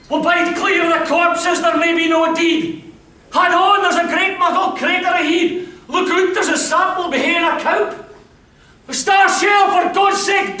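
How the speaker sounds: high, loud, hurried warnings; urgent guiding soldier. Acted. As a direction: steer a blind mate past craters. Guiding through no man's land.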